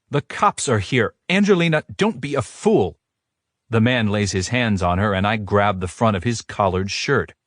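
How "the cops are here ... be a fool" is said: scolding father. A narrator's reading.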